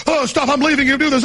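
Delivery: high-pitched flustered protest; imitated panic. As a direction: mimic a friend desperate to get out. Retold panicking friend quarrels.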